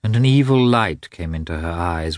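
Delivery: ordinary delivery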